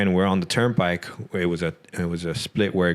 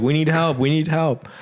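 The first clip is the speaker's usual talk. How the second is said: wife pleading for help; 8 semitones higher than usual. Retold or imitated. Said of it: "my wife is like".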